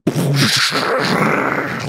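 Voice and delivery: long rumbling mouth-made boom; imitated explosion. Game master shows a skyscraper being hit, imitated explosion. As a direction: blow out an explosion noise that trails on.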